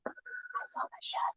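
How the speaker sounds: tiny whispered groundhog voice. Pretend. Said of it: "tiny groundhog voice will whisper".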